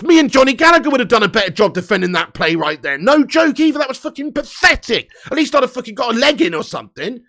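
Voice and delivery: ordinary talk, nothing marked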